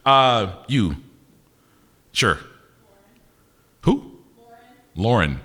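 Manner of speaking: ordinary delivery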